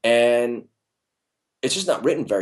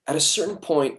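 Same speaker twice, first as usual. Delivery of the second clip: ordinary talk, nothing marked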